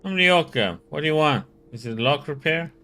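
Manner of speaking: loud, irritated nasal locksmith; annoyed nasally voice. Acted. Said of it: "in an annoyed, nasally voice".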